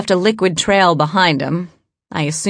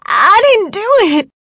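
desperate pleading; 6 dB louder than usual. Acted. Captured woman begging, desperate.

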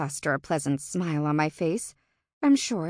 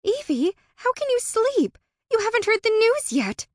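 much higher, eager and bubbly; excited; 5 dB louder than usual. A narrator's reading. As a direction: gush it, thrilled. Excited friend bouncing on bed.

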